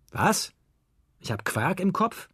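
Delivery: high, incredulous question; indignant cry. A narrator's reading. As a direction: call it back as an affronted father. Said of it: "rief da der Vater". (empört und hoch nachgefragt)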